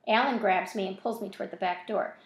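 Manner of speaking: ordinary delivery, nothing marked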